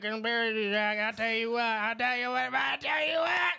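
strained, high-pitched slurred hollering; garbled put-on yell building in pitch; mock aneurysm. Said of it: "you just had an aneurysm".

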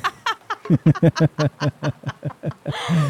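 rising burst of laughter. Real, not acted. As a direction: laugh at your own absurd story. Laughing at own embarrassing story. (gargalhada envergonhada)

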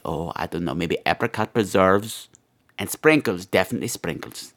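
ordinary delivery

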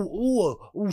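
ordinary talk

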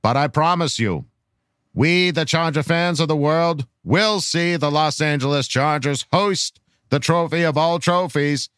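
put-on measured, old-fashioned presidential speech delivery; grand political orator. Reading a fan's rallying question to Charger Nation, grand oratory. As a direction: deliver a solemn, rousing address with dropped R's.

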